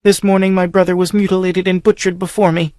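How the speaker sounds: high grieving voice; sobbing telling. A narrator's reading. Captive girl telling of her brother's death, sobbing. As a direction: force the words out through grief. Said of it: "She sobbed convulsively".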